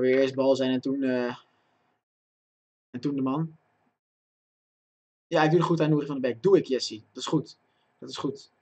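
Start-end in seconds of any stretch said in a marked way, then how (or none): none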